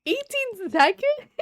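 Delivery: high, slow disbelief; stunned by the time; surprised. Real, not acted. Reacts to a quick finish.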